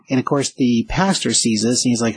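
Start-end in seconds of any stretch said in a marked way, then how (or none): none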